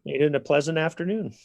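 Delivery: ordinary talk, nothing marked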